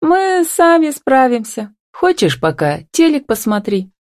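pointedly long-suffering offer; demonstrative sigh. A narrator's reading. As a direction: make a show of coping alone. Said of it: "демонстративно вздохнула она".

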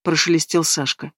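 ordinary delivery

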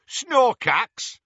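loud, high, contemptuous punch; angry berating. A narrator's reading. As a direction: end the tirade with scorn. Tirade continues between blasts.

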